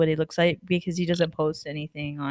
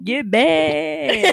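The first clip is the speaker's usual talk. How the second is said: higher drawn-out Gibby call; Gibby catchphrase; 7 semitones higher than usual. Retold or imitated. Friend quoting Gibby's catchphrase, high and drawn out. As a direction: sing out the name like the show. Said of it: "what does he say?".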